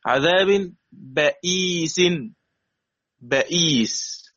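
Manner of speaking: بئيس slowly, the hamza with kasra articulated; teacher models بئيس twice; slow tajweed demo. Shown as a demonstration. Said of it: "يبقى الهمزة مكسورة وقبلها مفتوح". (بئيس ببطء وتجويد)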